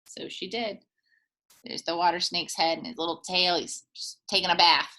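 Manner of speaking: plain reading voice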